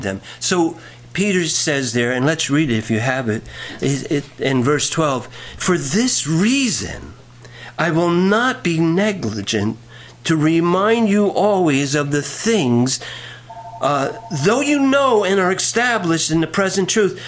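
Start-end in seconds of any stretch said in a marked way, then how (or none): 5.6-17.2 measured, projected preacher's reading; emphatic scripture reading. Acted. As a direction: read the verse out deliberately to the congregation. Reads scripture aloud to congregation.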